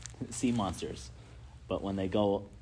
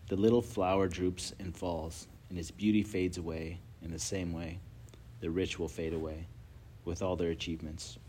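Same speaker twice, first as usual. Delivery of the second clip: scripture reading; 3 semitones lower, 4 dB quieter than usual. Acted. Reads scripture aloud.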